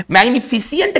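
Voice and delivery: "मैग्निफिसेंट" with an extra i, mag-ni-fi-si-ent; wrong pronunciation. Shown as a demonstration. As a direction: break the end of "मैग्निफिसेंट" into si-ent. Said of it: "मैग्निफिसेंट गलत है". ("मैग्निफिसेंट" का गलत उच्चारण, फि-सि-एंट)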